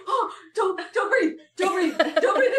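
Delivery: high, loud panicked pleading; freaking out. Retold or imitated. Mom panicking over a blueberry in the nose, high and loud. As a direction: beg her not to inhale. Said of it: "She's like".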